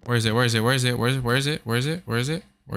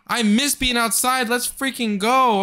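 excited relief; 10 semitones higher than usual. Real, not acted. Relief at reaching outside.

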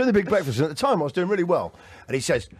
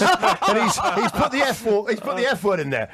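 usual speaking voice

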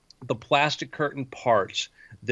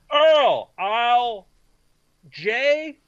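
calling out; 10 semitones higher, 5 dB louder, much slower than usual. Acted. Calls to worker in back.

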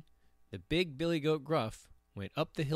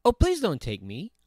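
not-so-small voice; 6 semitones higher, 5 dB louder than usual. A narrator's reading. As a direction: middle goat pleading, moderately high. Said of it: "his voice was not so small".

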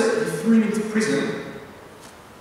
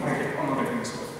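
low, soft, sulky refusal; mild mock stubbornness, voiced low; stubborn sulk; 7 semitones lower, 7 dB quieter, much faster than usual. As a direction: dig in like a hurt child who won't budge. Acted stubborn reply to "God's forgiven you.

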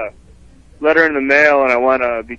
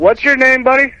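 indignant; 10 semitones higher than usual. Real, not acted. Caller demands the rep's name.

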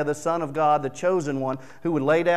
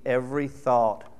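ordinary talk, nothing marked

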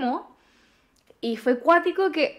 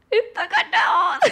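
slow, high laughing glee; gleeful giggle; laughing triumph; 7 semitones higher, slower than usual. Retold or imitated.

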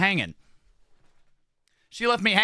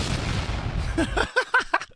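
high airy wheeze of a laugh; a silent breathy giggle burst; breathless laugh. Real, not acted. Streamer laughing at how weird he looks, airy wheeze. Reacting to his own face.

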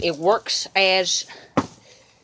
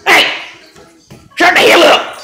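high, loud yell; mock shout; 10 semitones higher, 11 dB louder than usual. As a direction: bark it back at him. Yelling back at roommate.

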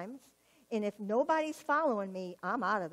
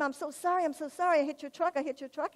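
retold frantic apology; 5 semitones higher, faster than usual. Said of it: "crying, hysterical".

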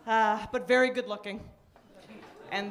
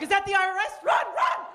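frantic high yell about the IRS; panicked shriek; 9 semitones higher, 5 dB louder than usual. Retold or imitated. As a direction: flee in mock terror.

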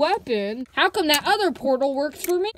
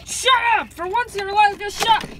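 angry; 6 semitones higher, faster than usual. Acted. Yelling at the nagging kid.

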